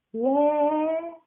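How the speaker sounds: high small "yeah"; high childlike yes; meek little boy. Acted. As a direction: kid admitting it. Kid answers.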